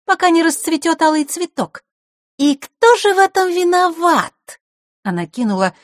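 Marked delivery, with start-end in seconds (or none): 0.1-4.3 put-on very high, loud girl's voice; Маришка. A narrator's reading. Girl carries on the complaint, high-pitched and loud. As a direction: pipe up high and singsong. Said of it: "продолжила девчонка".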